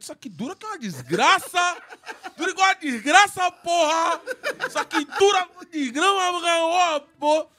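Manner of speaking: shouting through laughter, loud and high; excited laughing shout. Real, not acted. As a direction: crow about it, laughing hard. Excited boast about cheap rings.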